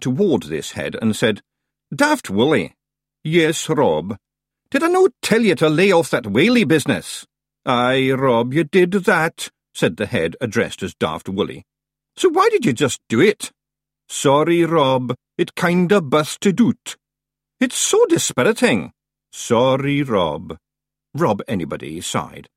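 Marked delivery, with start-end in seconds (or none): 1.9-9.5 high, loud Scottish Feegle voices; squabbling wee Scots warriors; Feegles bickering. A narrator's reading. 12.2-20.6 high, loud Scottish voices; exasperated chief and sheepish underling; Feegles bickering. A narrator's reading.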